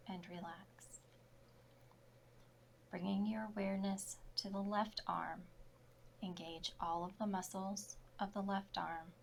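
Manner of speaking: usual speaking voice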